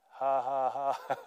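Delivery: deliberate, spaced-out ha-ha-ha; mock laughter in the devil's face; belly laugh. Shown as a demonstration. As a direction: demonstrate a put-on belly laugh. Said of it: "good old belly laughs".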